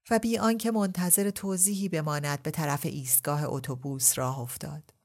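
ordinary delivery

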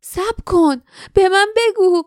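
high, urgent plea; desperate pleading. A narrator's reading. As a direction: beg loudly, voice lifted high. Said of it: "جاسمین به شدت التماس کرد". (التماس شدید)

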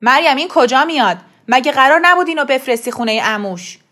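loud furious complaint to wife; angry father. A narrator's reading. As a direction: blame Maryam loudly. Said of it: "با عصبانیت". (پدری خشمگین و بلند)

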